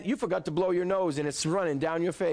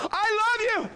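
much higher, louder, over-the-top gushing; gushing boyfriend; 5 dB louder than usual. Pretend. Preacher pretends his mother is his girlfriend, hammed-up gushing. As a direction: lay it on thick to a pretend girlfriend. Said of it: "and can you imagine".

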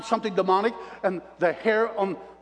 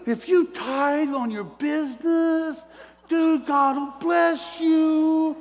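brother's excited pitch; 10 semitones higher, 3 dB louder, much slower than usual. Retold or imitated. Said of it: "He said".

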